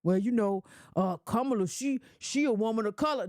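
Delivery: low, dopey mock supporter voice; imitating a voter. Mocking a Kamala supporter's excuse, low and dopey. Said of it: "tell me what they did to get you excited".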